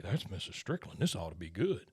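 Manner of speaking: low, hushed aside; Thatherton. Retold or imitated. Rival watches the wife storm over, quiet aside. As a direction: drop low and soft, as a bystander commenting. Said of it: "you hear Thatherton say".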